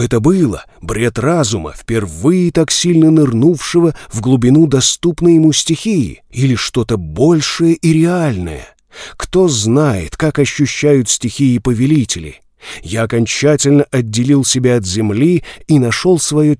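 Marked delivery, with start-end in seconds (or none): none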